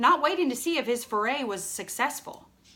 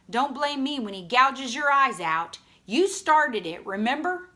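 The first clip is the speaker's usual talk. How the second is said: loud warning; 5 semitones higher, 7 dB louder than usual. Acted. Jim warns Dill heatedly.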